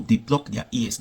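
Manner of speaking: ordinary talk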